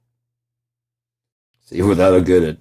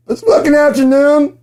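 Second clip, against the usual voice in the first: exasperated yell; 3 dB louder than usual. Real, not acted. Yells at computer.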